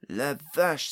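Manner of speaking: loud astonished exclamation; crying out. A narrator's reading. Pod amazed by the endless staircase, crying out. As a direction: blurt it out, loudly. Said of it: "s'écria Pod".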